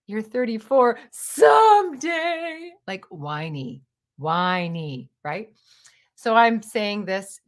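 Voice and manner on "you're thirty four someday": mock wailing. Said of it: "he's like".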